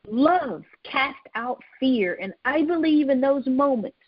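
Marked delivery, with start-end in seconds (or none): 0.1-2.2 slow emphasis. Real, not acted. Preacher's key line slowed down.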